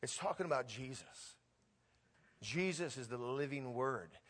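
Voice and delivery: ordinary talk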